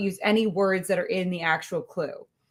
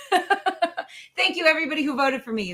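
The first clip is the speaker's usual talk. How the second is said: laughing; 7 semitones higher, faster than usual. Real, not acted. Laughing about award win.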